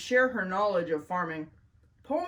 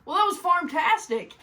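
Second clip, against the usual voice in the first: excited; 9 semitones higher, 4 dB louder than usual. Real, not acted. Bubbly host reaction.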